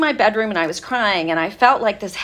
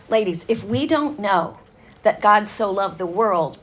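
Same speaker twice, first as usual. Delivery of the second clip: ordinary delivery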